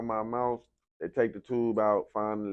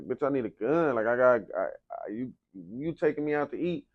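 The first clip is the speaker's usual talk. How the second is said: higher, louder, insistent demand; urgent; 5 semitones higher, 4 dB louder than usual. Retold or imitated. Telling his girlfriend he needs his gun first, urgent. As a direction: press her hard, no joking. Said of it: "But I was telling her".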